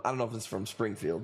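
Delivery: usual speaking voice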